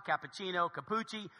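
ordinary delivery

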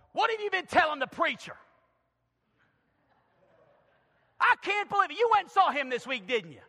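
high, loud angry accusation; screaming at wife. Acted. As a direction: berate her like a suspicious husband. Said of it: "you're screaming at her".

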